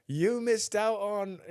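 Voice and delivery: slow, high-pitched show announcement; TV show host. Retold or imitated. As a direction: lightly imitate a host drawing out the reveal. Retelling the show's contestant announcement.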